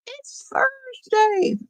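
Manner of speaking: high, slow dawning realisation; surprised. Retold or imitated. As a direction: say it as if it just hit you. Sudden realisation of the day.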